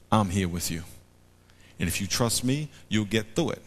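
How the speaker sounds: gentle, quiet reassurance; soft reassuring God. Acted. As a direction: speak softly like God comforting. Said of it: "God tells them".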